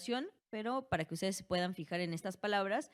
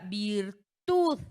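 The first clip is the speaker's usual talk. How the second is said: "virtud" with the final "tud" punched louder; stress on last syllable; 7 dB louder than usual. Shown as a demonstration. Teacher showing students the tonic syllable, last-syllable stress on "virtud". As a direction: draw out and hit the "tud" in "virtud". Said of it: "Virtud recae en la última".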